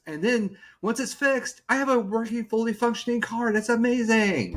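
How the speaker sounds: mock-awed wonder at a working car; exciting. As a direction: savor it like a gift. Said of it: "invigorating and exciting".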